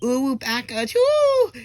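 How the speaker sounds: very high, loud anime squeal; anime Japanese. Retold or imitated. Alien weeaboo fighting back after a punch, shrieked anime Japanese. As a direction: squeal it like an over-the-top anime character. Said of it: "it was, like, anime Japanese".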